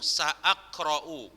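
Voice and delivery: slow "sa'akra'u" with a break after sa; careful "sa'akra'u", glottal catch after sa; hamza pronounced. Shown as a demonstration. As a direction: sound both a sounds in "sa'akra'u". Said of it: "bacanya ini".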